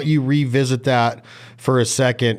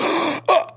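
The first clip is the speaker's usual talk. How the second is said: demonstrated sharp gasp. Shown as a demonstration. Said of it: "So it's like".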